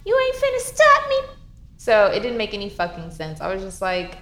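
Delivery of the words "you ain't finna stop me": much higher put-on voice, cocky and teasing; defiant kid. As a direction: taunt mom like a cheeky kid. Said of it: "And I would be like".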